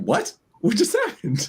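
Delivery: high, baffled "what just happened"; stunned disbelief. Retold or imitated. Viewer reacting to Dracula's quick defeat, stunned and high. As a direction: sound thrown by the sudden twist. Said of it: "It's like, like".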